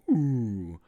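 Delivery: rough try at a drooling cartoon dad; Homer Simpson. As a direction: imitate a cartoon glutton longing for food. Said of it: "trying to remember what Homer Simpson says".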